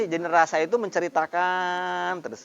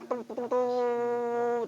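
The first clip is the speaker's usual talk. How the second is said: slow, soft, higher trumpet call; trumpet imitation; 5 semitones higher, 7 dB quieter, much slower than usual. Shown as a demonstration. Teacher imitates a trumpet for morning assembly, slow and soft. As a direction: hum it like a bugle for roll call. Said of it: "kalau ada trompet".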